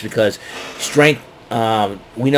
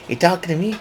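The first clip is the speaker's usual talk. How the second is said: De Niro impression; 6 semitones higher, 4 dB quieter, faster than usual. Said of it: "I get a kick out of saying that".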